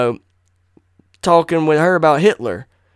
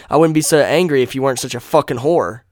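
ordinary talk

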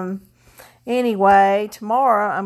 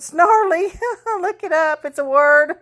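playful word; 8 semitones higher than usual. Real, not acted. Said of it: "That's my new word".

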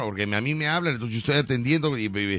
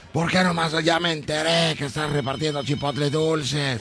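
retold shouting; 3 semitones higher than usual.

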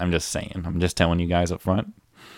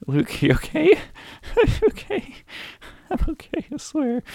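very high, teary hammed-up voice; mock crying voice. Reassuring he is okay while hamming up tears, very high. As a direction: play it as a fake sobbing mess.

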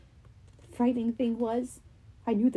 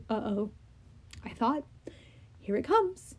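quiet inner thought; 7 dB quieter than usual. Acted. Percy's thought.

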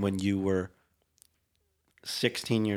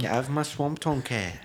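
raised-pitch amateur ogre impression; Shrek; 4 semitones higher than usual. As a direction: do your best Shrek. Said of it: "my best Shrek impression".

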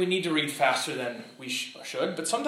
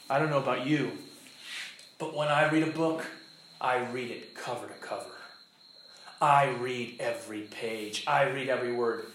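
low, softer mock declaration; bad reader imitation; 7 semitones lower, 6 dB quieter than usual. Mimics a self-satisfied bad reader.

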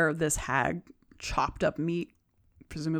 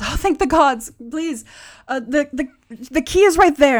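high, loud, urgent plea; stammering, relieved urgency; desperate pleading captive; 8 semitones higher, 9 dB louder than usual. Acted. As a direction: beg your rescuer in a higher, frantic voice. Captive woman pleads for rescue.

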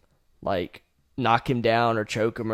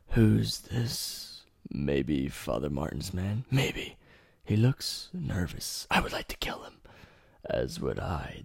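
low, hushed, menacing whisper; low creepy twin whispers, trading lines; 8 semitones lower, 9 dB quieter than usual. Acted. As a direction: murmur like twin killers plotting. Twins talking back and forth.

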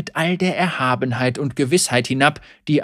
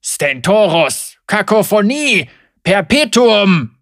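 loud preaching; 7 semitones higher, 8 dB louder, slower than usual. Acted. Heretic names the gods.

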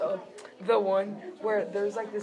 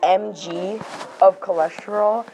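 ordinary talk, nothing marked

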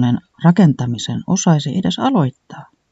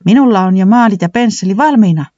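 a touch of eager delight; cheerfully ready to paint; joyful; 6 semitones higher, 3 dB louder than usual. A narrator's reading. Villavallaton eager to paint the new plane, joyful. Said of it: "iloitsi villavallaton".

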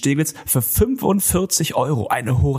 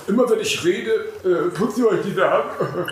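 mocking impression; 5 semitones higher than usual. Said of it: "Der sagt immer".

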